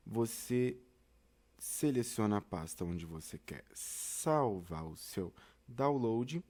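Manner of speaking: normal talking voice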